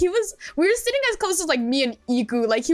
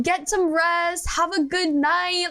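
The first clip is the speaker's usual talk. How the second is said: slow, higher, sing-song well-wishing; caring goodbye; 6 semitones higher, slower than usual. Retold or imitated. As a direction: stretch it out like tucking someone in. Retold caring goodbye to friend.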